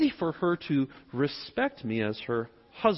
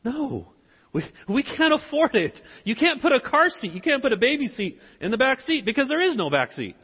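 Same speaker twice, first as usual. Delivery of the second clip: higher-pitched imitation of a wife refusing; mock exasperated wife, listing objections; objecting wife; 8 semitones higher than usual. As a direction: voice your wife putting her foot down. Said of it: "my wife was like".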